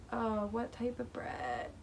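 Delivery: slow, hesitant question to the waiter; slow and unsure, asking the waiter aloud; slow, awkward ask. Retold or imitated. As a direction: stall for time, drawing it out. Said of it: "I'm like".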